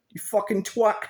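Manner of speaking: high, hammed-up English accent on twat; English accent insult. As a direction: spit twat like a mock English lad. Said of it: "I love that word".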